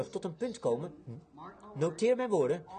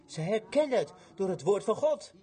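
ordinary talk

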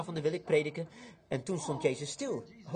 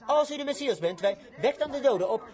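acted challenge; 6 semitones higher, 4 dB louder than usual. Acting the priest's challenge.

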